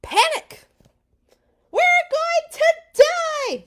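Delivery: very high, loud frantic shriek; terrified crowd shrieking; panicked shriek. Acted. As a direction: scream it like the end has come. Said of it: "they shrieked".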